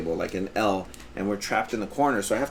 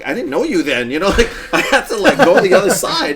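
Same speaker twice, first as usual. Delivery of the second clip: louder and higher, laughing through the words; 9 semitones higher, 10 dB louder than usual. Real, not acted. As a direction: laugh as you explain yourself. Laughing while retelling reply.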